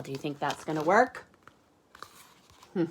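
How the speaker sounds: plain reading voice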